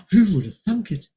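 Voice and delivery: sarcastic mock surprise; mock amazement. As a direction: play it as fake astonishment. Sarcastic remark on the reveal.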